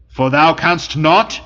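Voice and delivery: ordinary delivery, nothing marked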